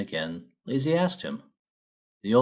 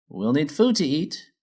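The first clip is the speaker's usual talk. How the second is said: chipper miner mare; 6 semitones higher than usual. Acted. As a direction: bounce in like a keen foreman. Feldspar lists demands.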